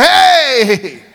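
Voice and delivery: loud, high, drawn-out mock holler; demon crying out. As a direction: break in with a long imitated yell. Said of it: "He started crying out".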